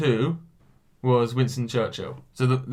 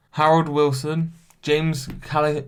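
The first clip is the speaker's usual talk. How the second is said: ordinary talk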